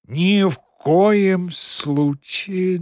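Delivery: slow, loud, drawn-out protest; Margarita's outburst. A narrator's reading. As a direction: start a shocked objection. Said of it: "начала Маргарита". (возмущённое восклицание)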